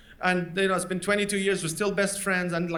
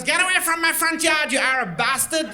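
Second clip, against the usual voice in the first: mock yell, higher pitch; 6 semitones higher than usual. Old man shouting at him off his yard, mock angry. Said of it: "He goes".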